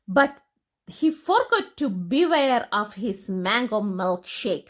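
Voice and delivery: ordinary delivery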